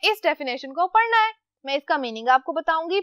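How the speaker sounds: usual speaking voice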